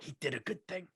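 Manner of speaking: hushed aside, quietly over the shoulder; confiding hush. Retold or imitated. Gym clerk confides to the speaker, hushed. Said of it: "she kinda looks over her shoulder".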